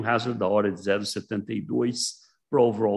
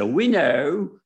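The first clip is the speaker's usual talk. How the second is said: imitating an Australian lecturer; a hint of an Australian accent; 5 semitones higher, 5 dB louder than usual. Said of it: "aquele sotaque australiano".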